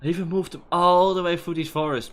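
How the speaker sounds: loud, angry rant at a teammate. Real, not acted. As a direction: shout it out, fed up and furious. Player furious at teammate's mistake.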